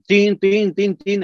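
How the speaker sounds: doorbell imitation. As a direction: chime it out like a doorbell ringing. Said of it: "Y le tocaba el timbre". (imitando el timbre, agudo y fuerte)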